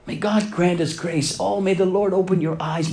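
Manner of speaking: ordinary delivery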